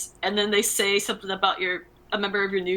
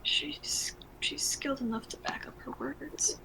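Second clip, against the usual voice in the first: soft, higher, whispery character voice; hushed reply; 4 semitones higher, 11 dB quieter, slower than usual. Acted. Air defending the human to the elf, hushed. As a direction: answer very quietly, almost whispered.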